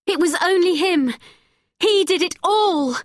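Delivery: put-on girl accusing the old man. A narrator's reading. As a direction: point the finger at him. Becky points at Scanlon.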